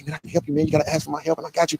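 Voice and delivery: smooth talking. Retold or imitated. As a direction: coax him in gently like a slick devil. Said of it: "he smooth talking".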